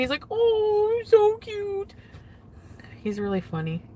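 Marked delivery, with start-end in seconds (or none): 0.3-1.9 mocking high cooing voice; imitating her cat voice. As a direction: tease her by copying the pet-talk. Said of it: "he was, like, imitating me".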